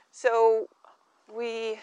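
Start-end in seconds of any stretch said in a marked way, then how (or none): 1.3-1.8 breathless while walking fast; out of breath. Real, not acted. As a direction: talk short of breath. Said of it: "I'm getting out of breath".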